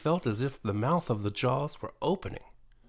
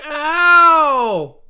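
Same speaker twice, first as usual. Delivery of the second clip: a sudden high, loud yelp of pain; high, loud creature cry of pain; shout; 15 dB louder than usual. A narrator's reading. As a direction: shout it out, hurt and surprised. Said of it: "he shouted".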